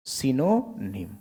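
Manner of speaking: सिनोनिम said very slowly as si-no-nim, each syllable clear; drawn-out सिनोनिम with a short i in the first syllable, not saa; careful model pronunciation. Shown as a demonstration. Teacher models सिनोनिम slowly for the class, careful pronunciation. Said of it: "मैं सटीक पढ़ूंगा".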